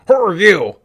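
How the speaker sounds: loud, high alien guard; alien trooper voice. Retold or imitated. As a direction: bark it like a strange alien. Stormtrooper voice, alien sound.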